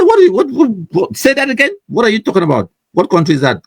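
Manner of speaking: high, shocked, disbelieving what; incredulous. Retold or imitated. Host mocks Nigerians saying Cameroon, high incredulous outburst. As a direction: react as if you can't believe your ears. Mock reaction to Nigerians' pronunciation.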